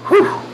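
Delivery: high-pitched puffed whew; bracing whew. Real, not acted. Teacher facing the next tough sentence, bracing whew. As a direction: puff out a quick, high whew.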